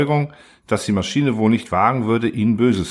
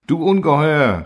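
high, louder cry of horror; shouted; 6 semitones higher, 3 dB louder than usual. A narrator's reading. The council cries out at the monster machine, high shout. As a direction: hurl the insult up high. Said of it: "schrien Spiriten und Erlauchte".

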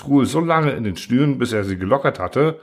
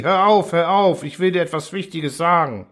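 screaming; 7 semitones higher than usual. Acted. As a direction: scream for him to stop. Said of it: "Truhl schrie".

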